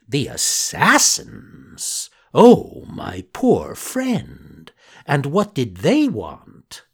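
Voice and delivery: very slow, hammed-up horrified fox; mock shocked fox. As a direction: fake sympathy, drawn out.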